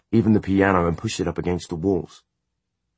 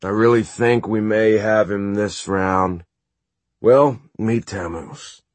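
lightly voiced playful warlock; breezy, slow showman voice; Magnus voice; 4 semitones higher, 4 dB louder, slower than usual.

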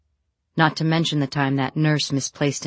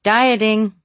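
calling out; 6 semitones higher, 6 dB louder than usual. A narrator's reading. Calling back up.

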